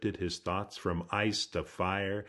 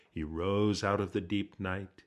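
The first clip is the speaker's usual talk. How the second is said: ordinary delivery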